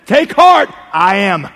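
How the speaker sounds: bold proclamation. Retold or imitated. Preacher gives Jesus's literal words, loud and triumphant. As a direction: ring it out as a declaration.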